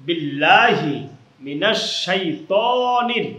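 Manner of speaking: slow recitation drawing out বিল্লাহি and শাইতানির; measured Arabic recitation with held vowels; long stretched vowels. Shown as a demonstration. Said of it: "হলুদে আছে টান".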